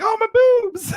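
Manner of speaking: very high squealing mock complaint; high falsetto teasing imitation; mock pained squeal. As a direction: squeal it like she's hurt. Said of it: "and you're like".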